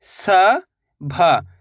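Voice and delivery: सभा stretched very slowly as स-भा; very slow drawn-out. Shown as a demonstration. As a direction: draw the word out for the class. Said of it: "दोनों को मिला देंगे तो". (सभा को बहुत धीरे खींचकर)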